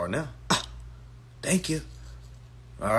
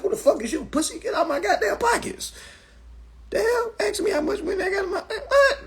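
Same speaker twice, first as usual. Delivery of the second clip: mock outrage. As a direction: act scandalized and shrill. Offended outburst at the bank.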